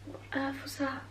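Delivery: startled whisper; hushed, spooked whisper. Real, not acted.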